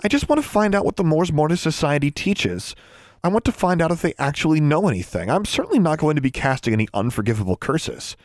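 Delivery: a hint of a defensive girl; lightly voiced schoolgirl. A narrator's reading. Alexandra voiced higher.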